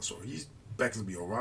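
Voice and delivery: plain reading voice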